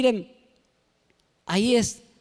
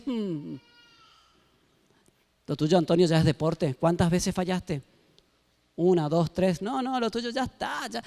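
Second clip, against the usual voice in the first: Satan's voice; 4 dB quieter than usual. Acted. Satan whispering accusations.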